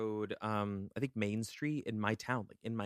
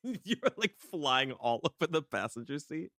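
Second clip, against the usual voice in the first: laughing; 6 semitones higher, 3 dB louder than usual. Real, not acted. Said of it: "I imagine him swerving".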